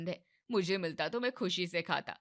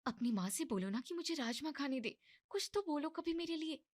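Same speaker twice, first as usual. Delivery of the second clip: softer, higher urgent plea; hushed complaint; 8 semitones higher, 6 dB quieter than usual. Acted. Wife quietly urges husband to speak up, softer and higher. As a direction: nudge your husband under your breath.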